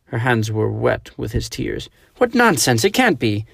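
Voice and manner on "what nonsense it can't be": high, loud anguished protest; tearful denial cry. A narrator's reading. Clarice to Mister Archelaus, anguished high cry. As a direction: refuse to believe it, voice cracking high.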